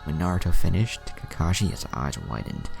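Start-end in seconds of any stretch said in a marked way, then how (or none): none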